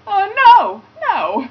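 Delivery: high, loud shocked "oh no"; horrified cry. Retold or imitated. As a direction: shriek it in horror. Friend sees the accidental tag.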